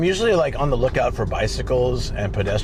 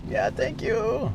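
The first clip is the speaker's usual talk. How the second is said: put-on high, slow little old lady voice; grandma voice; 11 semitones higher, 4 dB quieter, much slower than usual. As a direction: thank them like a sweet old grandma. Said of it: "I like the grandma voice".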